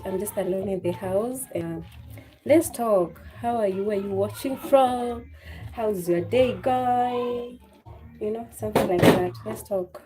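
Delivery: ordinary talk, nothing marked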